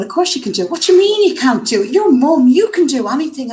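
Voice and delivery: mock-indignant cheerleading from her boy; son's eager encouragement. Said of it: "he's going".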